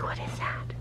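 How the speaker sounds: very soft, whispered question; wary dog whisper. Acted. Owner voices wary Toby facing the toy, whispering. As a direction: whisper it as a nervous little dog. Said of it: "Toby's like".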